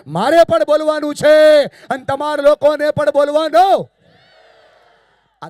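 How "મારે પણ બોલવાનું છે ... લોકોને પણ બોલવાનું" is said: slow, shouted group chant; loud with everyone. Shown as a demonstration. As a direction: shout it slowly with the whole hall. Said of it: "બધા મારી સાથે ઊંચા અવાજે". (બધા સાથે ઊંચા અવાજે ધીમો નારો)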